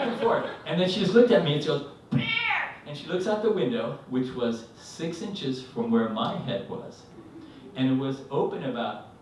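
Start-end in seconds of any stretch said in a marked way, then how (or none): 2.1-2.8 very high mock shriek; one piercingly high retold cry; imitated scream. Wife looking at husband, screaming warning.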